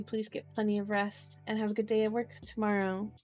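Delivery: usual speaking voice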